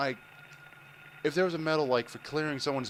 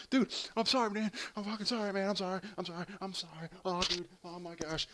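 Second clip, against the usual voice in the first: high, repetitive mock-agitated apology; frantic apologizing driver; 6 semitones higher than usual. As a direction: plead over and over in a higher voice, rattled. Voicing driver's agitated repeated apology.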